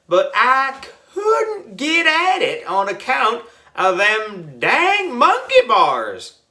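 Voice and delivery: high, slow country drawl; drawn-out backwoods character voice; folksy prospector. A narrator's reading. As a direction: grumble and wheedle like an old-time gold digger.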